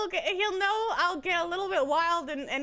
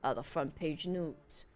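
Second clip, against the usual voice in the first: low narrator voice; 10 semitones lower, 5 dB quieter than usual. Acted. Reads game text in low voice.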